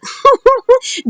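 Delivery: high-pitched chuckle; laughing at the story; amused laugh. Real, not acted. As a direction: burst into a short high laugh. Amused while recapping the show.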